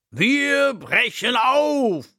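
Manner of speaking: high, slow elephant trumpet call. A narrator's reading. Elephant announcing the journey to the children, trumpeting. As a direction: boom it out like an elephant setting off. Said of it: "trompetet er".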